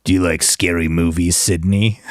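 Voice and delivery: low menacing movie killer; Scream killer voice. Retold or imitated. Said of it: "like Sydney".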